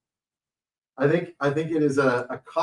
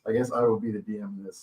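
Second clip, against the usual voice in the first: ordinary delivery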